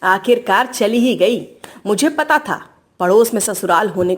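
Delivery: low-pitched, mildly angry grumble; grumbling angry mother-in-law. Acted. Mother-in-law alone after bahu left for her mother's, grumbling angrily. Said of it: "गुस्से में बड़बड़ा रही थी".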